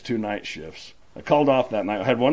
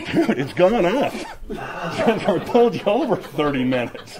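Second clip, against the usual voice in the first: slow, higher, laughing mock cop voice; amused cop; 9 semitones higher, 5 dB louder, slower than usual. Cop laughing at the breathalyzer reading, slow high mock voice. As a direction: drag it out like a cop who can't believe the reading. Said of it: "And he goes".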